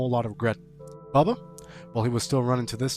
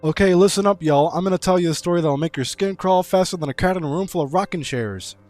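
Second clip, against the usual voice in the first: higher, hammed-up southern storyteller; attempted southern accent; 6 semitones higher, 7 dB louder than usual. Streamer opening a folksy tale for chat, attempted southern accent. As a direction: spin a yarn with a shaky attempt at a southern accent. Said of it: "I can't do a southern accent".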